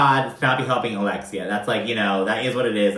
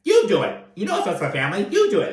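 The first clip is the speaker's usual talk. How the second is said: imitated angry outburst, pitched up and loud; pissed-off Todd; 8 semitones higher, 3 dB louder, faster than usual. Husband fed up with wife's apartment hunting, pissed off. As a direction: snap it out, mocking his temper. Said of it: "That's how fucking Todd talks when he's pissed off".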